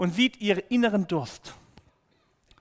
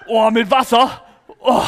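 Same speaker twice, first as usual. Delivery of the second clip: mock strain; 5 semitones higher, 12 dB louder than usual. Hauling a heavy water bucket.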